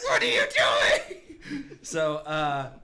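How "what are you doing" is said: high, loud mock scolding; exasperated yell. Man scolding the shouting party, exasperated yell. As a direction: yell it in comic disbelief.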